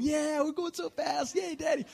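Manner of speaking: imitated gleeful children's voice; cheering kids. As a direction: squeal it like kids on a wild ride. Voicing his kids cheering on the cart.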